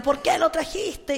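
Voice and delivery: high, slow imitation of a screaming girl; possessed girl's cry. Priest retells the girl pointing at the priest, high drawn-out cry. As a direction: stretch it into a high, accusing cry. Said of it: "la niña gritaba y apuntaba".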